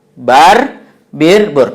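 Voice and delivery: bar, bir, bur with a, i, u clearly apart; vowel contrast drill. Shown as a demonstration. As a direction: drill the three vowels one by one. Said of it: "kata-kata ini terdiri dari huruf ba dan ra". (latihan bar, bir, bur dengan vokal berbeda)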